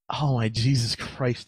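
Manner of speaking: ordinary talk, nothing marked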